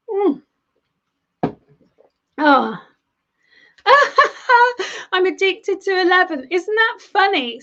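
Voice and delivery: usual speaking voice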